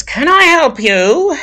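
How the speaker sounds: high, curled-lip sniff of a question; stern librarian greeting a strange visitor, sneering. Acted. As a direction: look down your nose at the stranger. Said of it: "she curls her lip".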